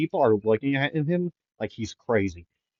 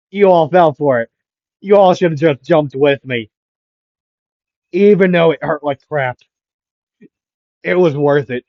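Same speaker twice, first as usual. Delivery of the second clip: louder, higher crowing; gleeful teasing; 4 semitones higher, 7 dB louder than usual. Acted. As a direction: gloat at the others. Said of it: "would be laughing at everyone".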